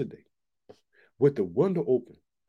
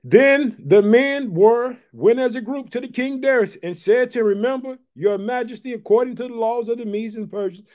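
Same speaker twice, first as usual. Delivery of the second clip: raised, louder preaching read of the passage; loud scripture reading from the pulpit; 6 semitones higher, 7 dB louder than usual. Acted. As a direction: proclaim the Bible text to the congregation. Preacher reads scripture aloud.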